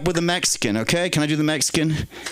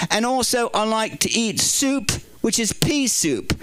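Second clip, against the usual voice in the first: lightly put-on Liverpool accent, higher; chirpy Northern English bloke, pitched up; comedy Liverpool voice, a touch higher; British accent; 6 semitones higher than usual. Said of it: "So we'll do the Chris Wheeler British".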